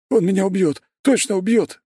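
very high, frightened cry; a cornered coward sure of his death; panicked croak. A narrator's reading. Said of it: "прохрипел граф". (панически, высоким сдавленным голосом)

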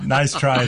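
high laugh then teasing words. Real, not acted.

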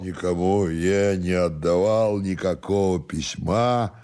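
put-on slow, soft drunken drawl; drunken stubborn servant. Drunken servant denies having a letter, slow stubborn drawl. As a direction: deny it sluggishly and quietly, like a drunk.

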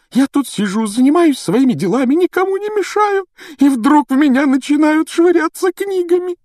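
very high, hysterical self-pitying tirade; wailing complaint. A narrator's reading. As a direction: wail every grievance in a piercing put-on voice. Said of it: "заголосила Миртл".

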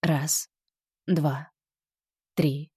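airy breathy count on leftover breath. Shown as a demonstration. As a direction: count on the last of the air, breathy. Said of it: "И на оставшемся воздухе скажите". (голос с придыханием)

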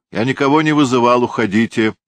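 fast loud shout through the door. A narrator's reading. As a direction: yell it at the door. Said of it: "крикнул через дверь хозяин".

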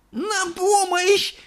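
high, drawn-out wail for help, slow; a terrified grandma calling out; old woman's cry for help. A narrator's reading. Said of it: "кричала бабушка Джозефина". (бабушка в страхе зовёт на помощь)

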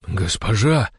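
stunned. A narrator's reading. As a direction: gasp the word out in astonishment. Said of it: "ошарашенно проговорил один из дружинников". (ошарашенно)

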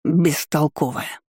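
slow, scornful put-down; dismissive snort. A narrator's reading. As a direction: drag the word out with contempt. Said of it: "Фыркнул он". (презрительно фыркая, медленно)

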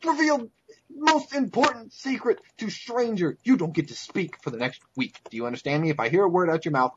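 enraged at a companion who spilled a secret; furious shout. Acted. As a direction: bark him silent. Character scolds a companion.

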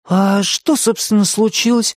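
high, bewildered question; puzzled little hero coming round; surprised. A narrator's reading. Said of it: "с удивлением спросил он".

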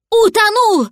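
high, loud cry; horrified realisation. A narrator's reading. Cat realising the dragon drowned, high cry. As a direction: cry out as the worst dawns. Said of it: "понял кот".

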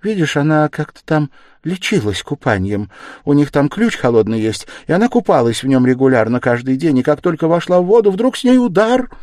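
high, loud, breathless storytelling voice; high-pitched, worked-up chatter; agitated mother recounting. Acted. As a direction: gush out the tale in rising alarm. Voices mother telling of a beating.

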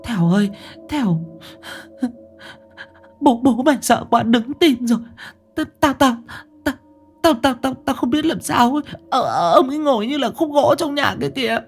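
put-on high, fast, stuttering old woman; stammering in terror. Mother tells son in panic, high stammering voice. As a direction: babble in panic, tripping on words. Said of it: "nói líu cả vào".

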